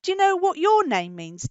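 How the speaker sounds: plain reading voice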